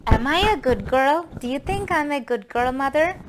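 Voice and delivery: hopeful little question, lifted high; eager girl asking. Retold or imitated. Summer as a girl asks mother.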